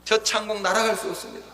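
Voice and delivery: high, impassioned prayer plea; wholehearted crying out. Acted. Preacher acting out a prayer to God, wholehearted crying out. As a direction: call up to God with all your heart. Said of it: "전심을 다해".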